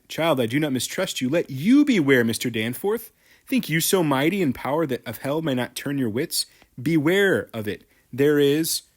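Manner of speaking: loud, menacing warning to the judge; threatening. Acted. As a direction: warn him that hell could take him too. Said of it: "So she's threatening him".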